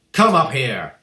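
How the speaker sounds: loud, commanding shout. A narrator's reading. Headmistress ordering the boy onto the stage, shouting. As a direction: order him up with a bellow. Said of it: "the Trunchbull shouted".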